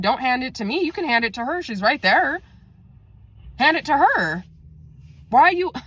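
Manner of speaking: high, incredulous retelling; retold protest. Telling the friend to hand over lunch, high protest.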